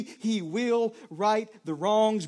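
slow, deliberate, each word weighted; slow declaration. Real, not acted. Pastor declaring God's justice to the congregation, slowly and firmly. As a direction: spell it out slowly with conviction. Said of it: "slow emphatic declaration".

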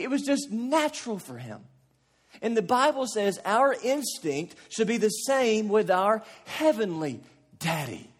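ordinary talk, nothing marked

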